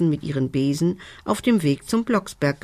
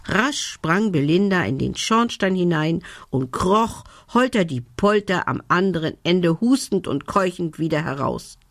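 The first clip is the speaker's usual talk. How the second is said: usual speaking voice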